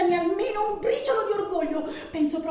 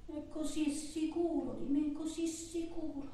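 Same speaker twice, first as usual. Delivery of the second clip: quiet brooding; 11 dB quieter than usual. Acted. Woman brooding on how sure he is of her, very softly. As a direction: say it under your voice, half to yourself. Brooding alone on his certainty.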